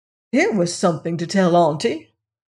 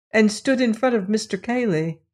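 plain reading voice

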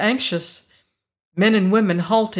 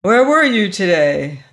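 loud cop's interrogation; hard, raised-voice questioning; demanding; 8 dB louder than usual. A narrator's reading. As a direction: demand an answer, forcefully. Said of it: "cop behind the wheel demanded".

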